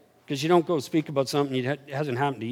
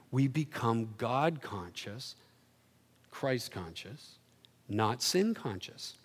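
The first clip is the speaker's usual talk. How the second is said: hushed emphasis; 5 semitones lower, 11 dB quieter, slower than usual. Real, not acted. Solemn key point.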